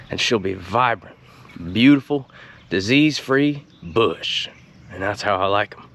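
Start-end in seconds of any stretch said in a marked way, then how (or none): 0.5-4.5 "disease-free bush" stretched out slowly and lovingly; slow savoring emphasis. Acted. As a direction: linger on every syllable of "disease-free bush". Drawn-out repeat of the phrase.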